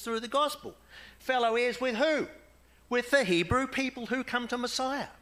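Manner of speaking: high, loud, emphatic preaching peak; fervent preaching. Real, not acted. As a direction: drive the point home from the pulpit. Preacher's climax on fellow heirs.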